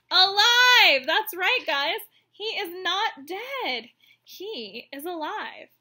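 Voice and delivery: loud high burst on alive, then slow emphatic; excited proclaiming. Real, not acted. Teacher announcing to children that he lives: excited, emphatic.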